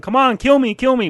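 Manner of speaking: mock desperate yell; imitated yelling. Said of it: "he's, like, yelling".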